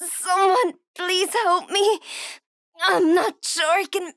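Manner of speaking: high, tearful plea through pain; tearful pleading. Acted. Wounded woman fears she won't make it, tearful pleading. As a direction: beg for help, close to crying.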